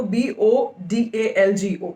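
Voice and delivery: slow, letter-by-letter "बी ओ डी ए एल जी ओ"; spelled out. Shown as a demonstration. Presenter repeating the spelling for viewers, "बी ओ डी ए एल जी ओ" spelled out slowly. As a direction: say "बी ओ डी ए एल जी ओ" one letter at a time, slowly. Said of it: "मैं spelling बता रही हूँ आपको".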